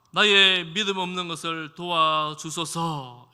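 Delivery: loud, slow, pleading cry; earnest loud cry. Retold or imitated. Desperate father crying out to Jesus, loud and pleading. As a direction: cry it out, begging for help. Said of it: "간절하게 소리를 지르니까".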